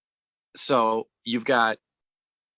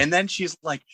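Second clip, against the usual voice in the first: ordinary talk, nothing marked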